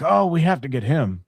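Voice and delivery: a hint of keen studio bigwig; lightly voiced Hollywood elite. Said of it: "a Hollywood elite would be like".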